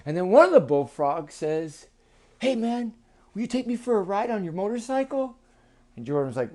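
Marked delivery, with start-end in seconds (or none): 2.4-5.4 lightly voiced high-pitched frog; a hint of a pleading little frog; eager bullfrog. Frog asking boy for a ride, lightly eager.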